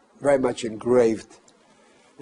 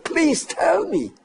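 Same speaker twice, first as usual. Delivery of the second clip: retold demand; 13 semitones higher, 4 dB louder than usual. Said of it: "And he said".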